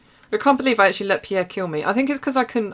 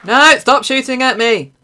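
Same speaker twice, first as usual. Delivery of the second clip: loud, much higher panicked yell; panicked shout; 9 semitones higher, 10 dB louder than usual. Real, not acted. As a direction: shout it under fire. Being shot in game.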